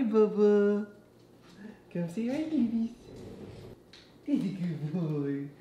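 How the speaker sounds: high, sing-song cooing at the dog. Real, not acted. Talking to her dog Dookie.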